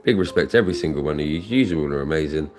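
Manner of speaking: ordinary talk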